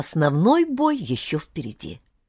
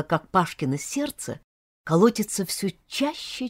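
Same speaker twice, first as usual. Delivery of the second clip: plain reading voice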